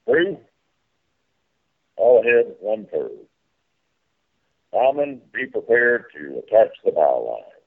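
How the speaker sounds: boat landing announcement over the PA; airport announcement. Pretend.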